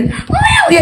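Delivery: very high mock baby crying owe; baby wail. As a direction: wail like a newborn, high and thin. Baby cry mixed with laugh.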